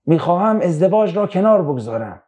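ordinary talk, nothing marked

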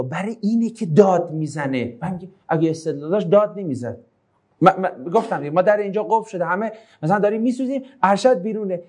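normal talking voice

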